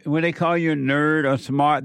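ordinary talk

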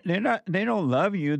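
usual speaking voice

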